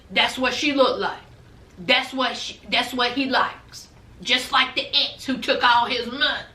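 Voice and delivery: put-on mother sizing up her son's girlfriend, low scornful voice; imitating the mother. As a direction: drop low and throw shade like his mama. Dante's mother insulting son's girlfriend.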